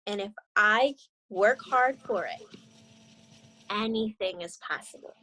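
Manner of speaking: gentle read-aloud storytelling voice; story narration. A narrator's reading. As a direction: read it like a picture book to young students. Teacher reads picture book aloud.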